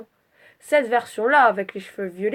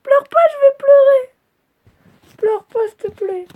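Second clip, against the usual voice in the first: put-on very high, soothing baby-talk voice, rising higher; cooing baby talk; 9 semitones higher, 10 dB louder than usual. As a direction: coo at the crying picture like a tiny child. Cooing to a crying drawn character. (voix de bébé très aiguë pour consoler une petite patate)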